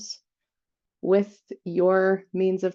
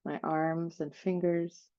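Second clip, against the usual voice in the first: guided meditation; 3 dB quieter than usual. Real, not acted. Host leading listeners through an energy cleansing, guided meditation. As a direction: softly guide them down through the body, phrase by phrase.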